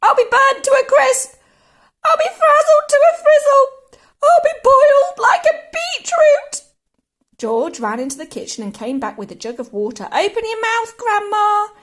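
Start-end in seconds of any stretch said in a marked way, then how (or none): 0.0-6.6 high, loud, building screech; frantic yelling. A narrator's reading. Grandma fearing she'll burn, frantic screeching. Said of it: "she yelled". 10.1-11.7 high, loud calling command; urgent cry. A narrator's reading. George rushing in with water, urgent cry. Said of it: "he cried".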